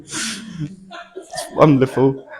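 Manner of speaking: laughing through it, very slow; enjoy your own joke; the medium laughing at the punchline of her joke. Real, not acted.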